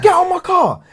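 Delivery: high-pitched, loud retold yell; outraged confrontational holler; angry shout. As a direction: act out ordering an intruder out at full volume. Retelling shouting at intruder in car.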